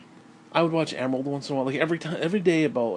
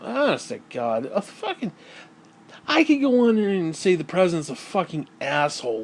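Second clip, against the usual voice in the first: normal talking voice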